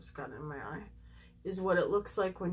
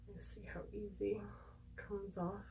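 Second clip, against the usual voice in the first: whispering; 12 dB quieter than usual. Real, not acted. Whispered aside testing mascara.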